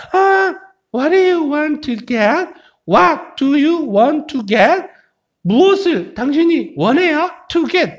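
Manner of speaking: high, slow dialogue character voice; high-voiced questioner. Teacher acting A asking what you want, high character voice. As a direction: ask way up high, spacing the words.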